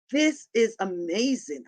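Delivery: usual speaking voice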